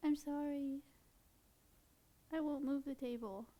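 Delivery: quiet soothing pet talk; coaxing. Real, not acted. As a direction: win the bird back gently. Soothes the angry bird.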